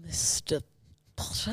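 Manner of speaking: halting, hushed sinister professor impression; Severus Snape. Contestant imitating a Hogwarts professor, low and halting. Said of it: "Severus Snape".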